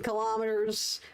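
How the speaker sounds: ordinary talk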